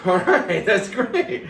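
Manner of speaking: high, laughing delight at good news; amused, high-pitched acceptance; laughing reply. Retold or imitated. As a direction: play the pleased friend through a laugh. Retold reply, told through laughter.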